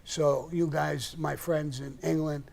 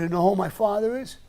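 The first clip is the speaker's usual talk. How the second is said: menacing retort; 6 semitones higher, 7 dB louder than usual. Retold or imitated. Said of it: "the way she said it".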